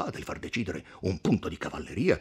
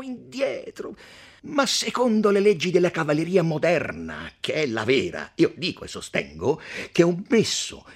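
higher, lightly voiced mocking noble; the count mocking the ancient Romans; scornful count; 13 semitones higher, 8 dB louder than usual. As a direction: roll out each invented word with relish. Count mocks ancients, coins words.

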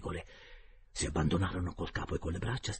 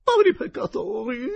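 much higher, loud and slow tearful wail; wailing lady; 11 dB louder, slower than usual. A narrator's reading. Geltrude begging the uncle's spirit, slow high tearful wail. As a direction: plead through tears. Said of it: "esclamò la signora Geltrude".

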